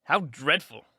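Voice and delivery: ordinary delivery, nothing marked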